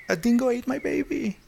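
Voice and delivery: quoting the famous film line; Meryl Streep quote. Retold or imitated. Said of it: "la famosa línea". (cita de película, voz más aguda)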